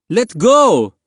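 high, loud command; yelling. A narrator's reading. Woman in the dinghy to boy in the water, yelling. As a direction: yell it down hard, pitch shooting up. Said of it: "yelled down at him".

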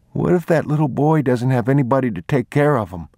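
put-on a small boy fretting aloud; boy's voice. A narrator's reading. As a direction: ask it as an anxious child.

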